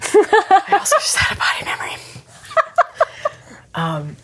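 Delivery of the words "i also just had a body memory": high breathy giggling whisper; laughing whisper. Pretend. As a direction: tease back in a giggly whisper.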